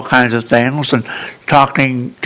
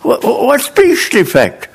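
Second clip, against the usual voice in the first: higher, stammering mock outrage; Boston friend protests to his German tutor, stammering; indignant; 10 semitones higher than usual. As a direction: sputter in disbelief.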